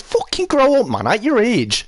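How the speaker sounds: exasperated, high-pitched outburst dropping at the end; angry, sweary scolding; real anger. Real, not acted. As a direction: snap it high, then come down scolding. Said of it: "because I'm angry about it".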